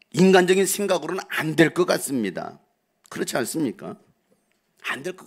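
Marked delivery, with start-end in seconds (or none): none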